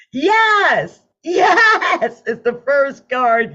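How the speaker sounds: high, loud laughing cheer; thrilled shout at the reveal; excited yes. Real, not acted. Celebrating the card reveal.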